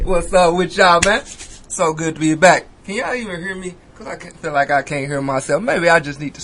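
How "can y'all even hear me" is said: ordinary talk, nothing marked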